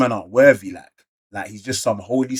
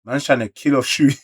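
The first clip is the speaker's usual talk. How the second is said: usual speaking voice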